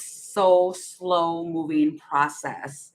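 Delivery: stretched, slow words for emphasis; drawn-out slowness. Real, not acted. Card reader describing a process taking forever, drawn out. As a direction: drag it out to show the slowness.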